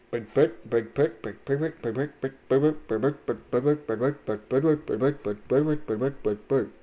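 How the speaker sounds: repeated clipped brick chant; silly mouth noises. Real, not acted. Gaming alone, chanting silly brick noises. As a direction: bounce through the brick chant. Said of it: "the noises that I make with my mouth".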